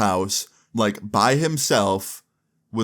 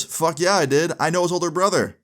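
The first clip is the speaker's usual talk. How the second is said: much higher, emphatic comeback; pitched-up, pumped retort; retold cocky confirmation; 7 semitones higher than usual. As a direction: imitate a brother proudly owning up to it. Brother proudly confirms meeting him.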